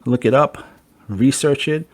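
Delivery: ordinary talk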